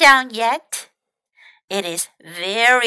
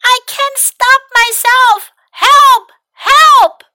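very high, loud panicked cry; panicked cries; 13 semitones higher, 10 dB louder than usual. Acted. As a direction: yell for help, out of control. Mac sliding out of control.

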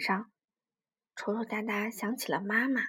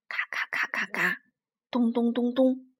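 rapid-fire crack syllables, then booming thuds; imitated cracks and thuds. A narrator's reading. Narrator voicing the island breaking in the quake, percussive. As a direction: hammer out each repeated noise.

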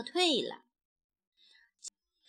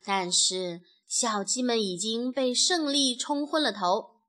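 ordinary delivery, nothing marked

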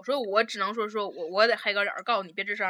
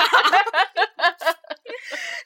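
loud giggling fit; high rising laughter; 9 semitones higher, 6 dB louder than usual. Real, not acted. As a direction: crack up. Laughing hard. (爆笑)

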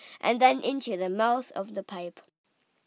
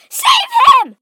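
piercingly high, loud scream; panicked mother's shriek for help; 17 dB louder than usual. A narrator's reading. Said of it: "screamed Missus Gloop".

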